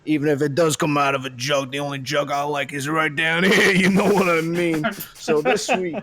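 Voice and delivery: put-on lightly done Brooklyn tough-guy accent; a half-hearted Brooklyn wise guy bit; casual Brooklyn accent, hammed up a little. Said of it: "real issues with the accent and the mustache combo".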